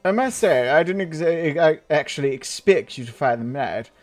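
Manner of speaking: pompous British lord voice; stuck-up British man. Acted. Proud noble granting a request, pompous British accent. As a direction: read it as a self-important British gentleman. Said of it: "like a really up himself British guy".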